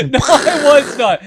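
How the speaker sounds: loud, high, drawn-out denial through laughter; laughing protest. Real, not acted. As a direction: protest at being mimicked while cracking up.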